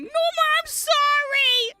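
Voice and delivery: loud, high, drawn-out mock wail; crying apology. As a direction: wail the apology like a hammed-up sobbing kid. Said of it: "and he's crying".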